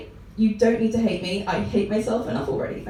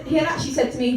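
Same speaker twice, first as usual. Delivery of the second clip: ordinary talk, nothing marked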